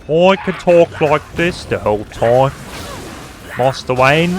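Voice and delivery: loud, slow voice demo; put-on character voice. Shown as a demonstration. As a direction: project each word slowly in a put-on voice.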